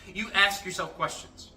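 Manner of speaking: usual speaking voice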